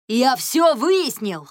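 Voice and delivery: slow, loud, high shout; triumphant yell. A narrator's reading. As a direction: bellow the news. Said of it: "от такого вопля". (медленно, громко, торжествующе)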